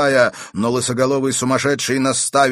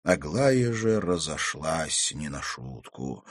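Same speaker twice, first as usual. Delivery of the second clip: ordinary delivery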